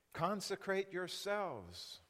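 slow, weighty command; solemn divine command, slowly. Acted. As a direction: lay each word down slowly. Quoting God's command slowly.

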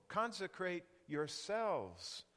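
slow, weighty command; solemn divine command, slowly. Acted. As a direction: lay each word down slowly.

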